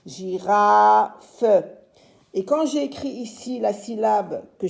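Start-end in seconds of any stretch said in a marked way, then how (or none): 0.1-1.4 "Girafe" split slowly as "Gi-raaa-fe"; louder, stretched "Gi-ra-fe" with a final "fe"; syllable by syllable. Shown as a demonstration. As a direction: sound out "Gi-ra-fe" in three beats. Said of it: "où est-ce qu'on va placer chaque syllabe".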